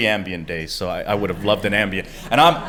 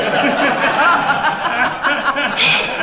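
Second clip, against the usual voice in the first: high burst of real laughter; laughing hard at the hash retiree; big laugh; 4 dB louder than usual. Real, not acted. Teller laughing at his own tale, high and loud.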